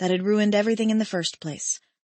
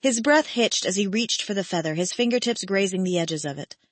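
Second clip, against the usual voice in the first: ordinary delivery, nothing marked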